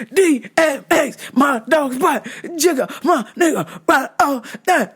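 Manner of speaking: [name] impression. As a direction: channel a rowdy rapper chanting. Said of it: "The ghost of D M X was inside of this man".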